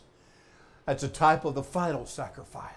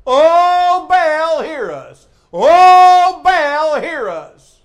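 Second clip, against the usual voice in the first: loud, high drawn-out wail, falling away; hammed-up pleading chant to a god; crying out; 13 semitones higher, 16 dB louder, much slower than usual. Preacher as Baal's prophets begging to be heard, crying out. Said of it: "they start crying".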